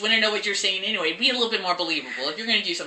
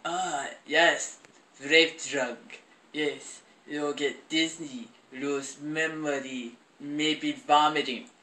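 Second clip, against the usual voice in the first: low, slow mock German accent; badly faked German accent, drawn out and softer; 6 semitones lower, 5 dB quieter, much slower than usual. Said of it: "in a really bad German accent".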